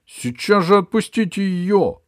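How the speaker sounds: loud, high angry shout. Acted. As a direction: yell it out to stop them. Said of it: "крикнул Пашка". (громкий крик)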